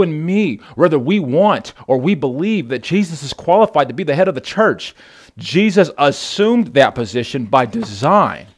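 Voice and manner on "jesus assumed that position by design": usual speaking voice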